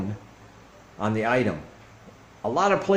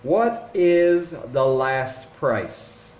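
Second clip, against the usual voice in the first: slow question; much slower than usual. Shown as a demonstration. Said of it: "find out".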